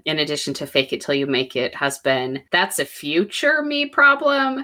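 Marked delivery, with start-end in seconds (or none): 2.5-4.6 breezy motto. Retold or imitated. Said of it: "My other philosophy has been".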